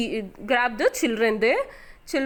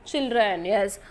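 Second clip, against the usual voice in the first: usual speaking voice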